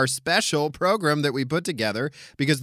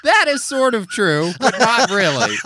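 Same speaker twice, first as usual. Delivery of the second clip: loud laughing denial; 7 semitones higher, 7 dB louder than usual. Real, not acted.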